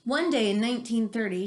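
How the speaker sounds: ordinary talk, nothing marked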